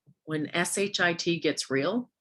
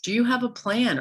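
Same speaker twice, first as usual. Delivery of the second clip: ordinary delivery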